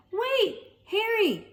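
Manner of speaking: high, slow call; calling after. Acted. Tom calling Harry as he flees, high call. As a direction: call after the running cat.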